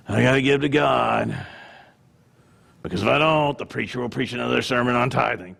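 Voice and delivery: big sigh, then low grudging drone; put-on heavy obligation, pitched right down; mock reluctant giver. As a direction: sigh and give in wearily. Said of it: "your attitude reflects how and why you tithe".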